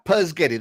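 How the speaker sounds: "Puzgetti" with no opening S, a Z before the G; kid's garbled spaghetti, "Puzgetti"; kids' mispronunciation. Retold or imitated. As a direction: say "Puzgetti" as PUZZ-getty. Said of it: "That's how they say it".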